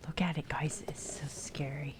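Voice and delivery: hushed whisper to the viewers; soft unvoiced hush; mock-spooky whisper. Real, not acted. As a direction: whisper it like it's a ghost story. Shows viewers spooky box.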